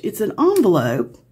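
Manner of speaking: usual speaking voice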